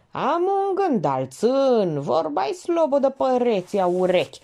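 high dialect voice, drawn out at the start; coaxing, needling Georgeta. A narrator's reading. Georgeta baits Elena, wanting to be begged, needling.

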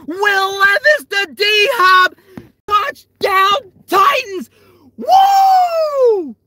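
screaming high mock play-by-play of a touchdown; touchdown call. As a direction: yell it like the winning score just landed. Calling an imagined touchdown play.

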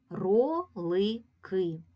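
Ролики said slowly in separate beats Ро-ли-ки; slow segmented Ролики; syllable by syllable. Shown as a demonstration. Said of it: "звукова моделька ось цього слова".

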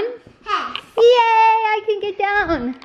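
playful cheer. Pretend. Rescued in the game.

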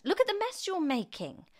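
put-on high, loud boy's complaint; indignant boy. A narrator's reading. George scolds grandma for the mess, indignant boyish cry.

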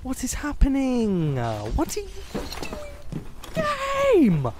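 drawn-out wail rising to a high yell; real anger. Real, not acted. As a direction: lose it at the game, pitch climbing to a shriek. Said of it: "I'm getting so angry".

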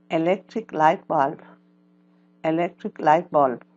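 loud, slow-ish teacher drill, each word twice; vocabulary drill. Shown as a demonstration. Teacher drills English words for students to repeat, loud. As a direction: model each word for the class to echo.

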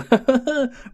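sudden high burst of laughter; laughing. Real, not acted. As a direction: laugh out loud at the joke. Laughs at own joke.

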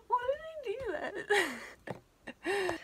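high, breathless laughing squeal; words squeezed out through real laughter; laughing hard. Real, not acted. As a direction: crack up mid-question. Laughing at her own clip.

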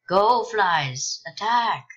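loud, high rallying call; shouted battle command. Pretend. Player orders game flies at an enemy, shouted command.

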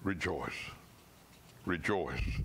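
rejoice said low and soft; low tender call. Shown as a demonstration. Pastor gently urging his dear ones to rejoice, low and tender. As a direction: breathe it out gently to the flock. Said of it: "Dear ones".

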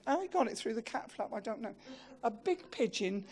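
normal talking voice

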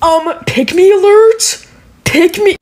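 high, slow sneering mock. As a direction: taunt like a snarky commenter. Mocking TikTok users.

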